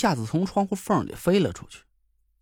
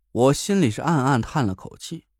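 ordinary delivery

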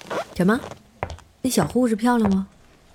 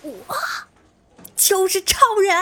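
pitched way up for a proud reveal; grand reveal; 11 semitones higher than usual. Acted. Stranger asked if he knows Superman, proud high reveal. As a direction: announce himself as a hero, high.

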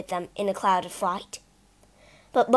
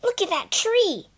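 higher, alarmed exclamation; crying out; 10 semitones higher than usual. Acted. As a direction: point it out in alarm. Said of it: "cried Peter".

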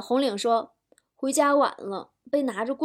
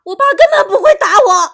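high, full-volume protest; exasperated child screaming back; outraged yell; 9 semitones higher, 14 dB louder than usual. Acted. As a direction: blow up at your mother, shouting high. Child's outburst at mother, punchline.

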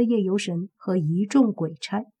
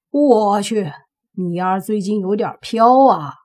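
glaring retort; 7 dB louder than usual. A narrator's reading. Friend glares at Mo Fan after being teased, loud retort. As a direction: burst out at a cocky friend. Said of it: "宁无心瞪着莫凡说道".

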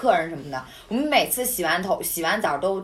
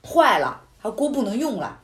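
ordinary talk

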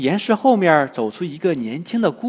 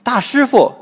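high, louder cry of alarm; flustered cry; 8 semitones higher, 5 dB louder than usual. A narrator's reading. Disguised girl cries to the monk, flustered. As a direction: call out hurriedly for protection. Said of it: "慌忙喊一声".

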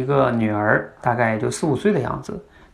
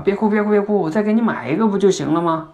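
higher, hurried pleading dad; imitating a flustered father begging her to stop; 8 semitones higher, 3 dB louder than usual. Father begging his crying daughter to stop, flustered and higher. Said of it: "就更，更着急了".

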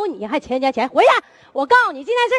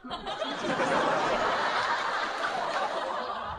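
airy high laugh, no voice; wheezing giggle; breathy laugh; 5 semitones higher, 12 dB quieter than usual. Real, not acted.

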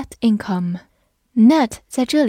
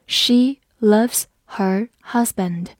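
each word set apart, slowly; slow read-along; much slower than usual. Shown as a demonstration. Teacher modelling an example sentence, slow and separated. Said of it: "好，我们慢读一遍".

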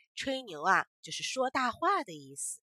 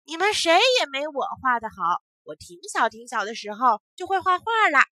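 put-on chin up, loud bragging boy; loud boasting; 5 semitones higher, 12 dB louder than usual. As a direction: brag it loudly to everyone. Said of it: "就扬起脸说".

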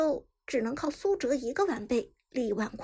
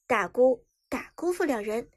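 ordinary delivery

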